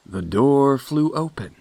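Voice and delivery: ordinary delivery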